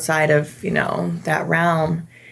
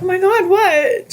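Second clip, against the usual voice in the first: high, loud startled "what?"; alarmed surprise; 12 semitones higher, 4 dB louder than usual. Retold or imitated. Marcy startled when her friend says she has something to tell. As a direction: jump up in pitch, startled and worried.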